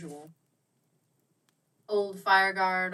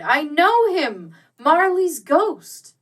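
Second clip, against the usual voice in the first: slow, much higher and louder cry; 9 semitones higher, 12 dB louder, much slower than usual. Acted. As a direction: call it out slowly, ringing and high. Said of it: "as though it cried".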